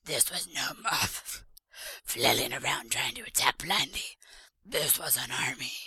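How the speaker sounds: low, rough whispery character voice; Bert. Shown as a demonstration. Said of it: "I should have said that in my Bert voice".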